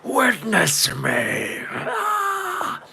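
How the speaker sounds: slow drawn-out battle cry, a touch hammed-up; hammed-up war-boy yell of witness me; mock Mad Max scream. Streamer jokingly acts a Mad Max war boy, hammed-up slow battle cry. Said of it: "while screaming".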